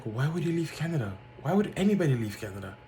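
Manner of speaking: imitating a stunned clerk, fast; disbelief. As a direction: play a clerk who can't believe anyone would leave. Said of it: "She was in disbelief".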